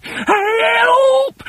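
very high, loud panicked shriek; screaming for help. A narrator's reading. Wife shrieks at the thing in her bed, panicked scream. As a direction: shriek in terror. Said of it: "screamed Missus Twit".

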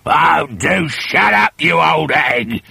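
put-on slow, loud gruff old man; grumpy husband snapping. A narrator's reading. Husband tells his nagging wife to shut up, slow loud snap. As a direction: bark it at her slowly. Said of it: "Mister Twit said".

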